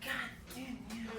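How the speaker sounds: under-breath muttered grumbling; mock road-rage mutter; muttered curse. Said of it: "We're like".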